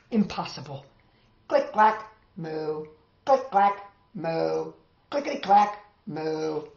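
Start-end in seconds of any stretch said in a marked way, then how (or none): none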